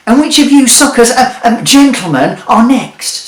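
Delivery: a cocky market trader of a wizard selling junk; brash wizard. A narrator's reading. Wizard mocking Jeremy and selling him a sat-nav, brash and loud.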